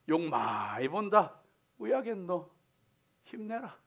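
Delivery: consoling words in Gyeongsang accent; Gyeongsang dialect. Shown as a demonstration. Preacher telling people to hang in there, Gyeongsang dialect. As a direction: say it like a Gyeongsang local. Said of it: "경상도 말로 하면은요".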